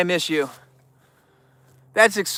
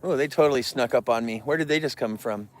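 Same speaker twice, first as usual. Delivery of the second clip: ordinary talk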